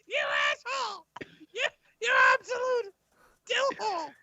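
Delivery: high, fast, sobbing insults; high crying yell. Acted. GM voicing a betrayed NPC, sobbing high yells. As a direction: scream through tears at the trickster.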